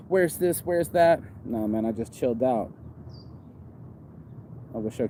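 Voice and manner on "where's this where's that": high-pitched, hammed-up pestering questions; mock frantic asking. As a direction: act out hassling a store clerk, pitched up. Acting out frantic parts-store calls.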